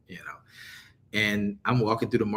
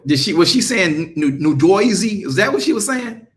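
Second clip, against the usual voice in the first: louder, mock-scandalised question; playful outraged call; 8 semitones higher, 9 dB louder than usual. Real, not acted. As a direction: act shocked at the accent, louder. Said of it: "Now that's disrespectful".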